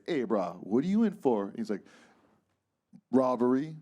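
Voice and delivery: usual speaking voice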